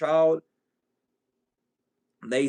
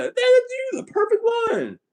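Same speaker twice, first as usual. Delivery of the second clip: squeaky high mock delight; high mock praise; 4 dB louder than usual. Family gushing over their gifted child, high mock delight. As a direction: gush in a shrill-high mock voice.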